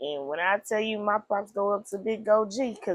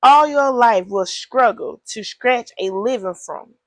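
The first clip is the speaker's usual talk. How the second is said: loud reading; 2 semitones higher, 9 dB louder than usual. Acted. Reading the curse.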